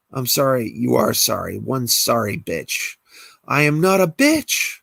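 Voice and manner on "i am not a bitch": high, loud offended yell; furious shout. Acted. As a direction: scream back at the insult. Alexis enraged at insult.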